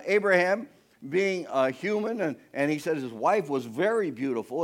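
normal talking voice

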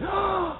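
sharp high shocked gasp; scandalized intake of breath; offended gasp. Retold or imitated. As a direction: gasp in mock outrage. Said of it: "immediately took offense".

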